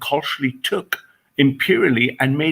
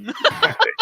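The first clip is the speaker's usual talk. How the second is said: squealed exactly. Acted. Agreeing in two contrasting takes.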